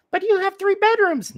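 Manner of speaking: put-on high, laughing childish whine; whiny kid. Kid pleading, voiced by host.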